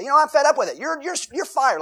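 high, loud mock outburst of a fed-up boss; angry boss. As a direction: blurt out the firing in exasperation. Acted angry boss firing.

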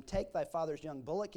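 ordinary delivery

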